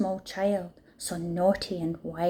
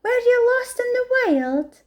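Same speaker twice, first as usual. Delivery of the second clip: elephant asking; 4 dB louder than usual. A narrator's reading. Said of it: "Elephant asked".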